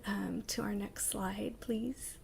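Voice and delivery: soft whisper, asking off to the side for the next slide; whispered aside. Real, not acted. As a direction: whisper the request quietly, out of the presentation.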